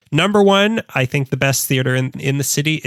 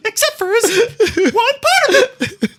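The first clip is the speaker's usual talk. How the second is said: very high, slow, laughing; squeaky cartoon voice; slower than usual. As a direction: giggle it out high. Joke in squeaky voice.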